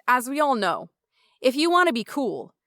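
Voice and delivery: normal talking voice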